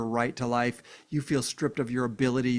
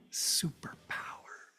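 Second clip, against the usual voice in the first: slow, breathy whisper of one word; 10 dB quieter, much slower than usual. Acted. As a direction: breathe it out like a secret. Whispered emphasis.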